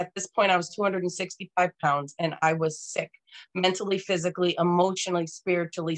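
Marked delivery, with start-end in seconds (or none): none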